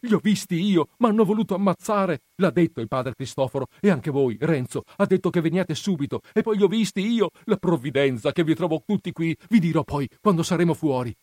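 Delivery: high, loud, agitated rush of news; panting, agitated warning. A narrator's reading. Menico telling Renzo of the danger, agitated warning. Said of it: "riprese Menico ansante".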